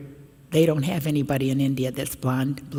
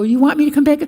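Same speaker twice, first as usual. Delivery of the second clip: delighted surprise; 10 semitones higher, 8 dB louder, faster than usual. Retold or imitated. Delighted reply to kids.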